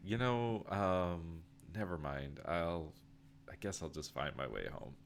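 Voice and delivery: disappointed, giving up softly; dejected, fading. Acted.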